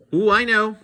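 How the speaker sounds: high, loud eager chirp; chirpy monster. Acted. Eager red monster answers Mister Z, high chirp. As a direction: pipe up like a keen pupil. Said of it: "chirped the red monster".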